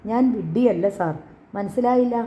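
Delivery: usual speaking voice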